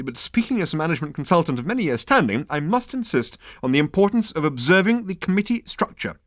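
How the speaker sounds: high, officious insistence; huffy. A narrator's reading. Management consultant insists on procedure, huffy. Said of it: "said the man huffily".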